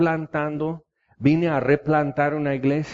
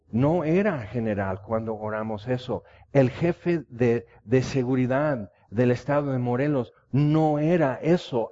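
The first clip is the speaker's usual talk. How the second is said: ordinary talk, nothing marked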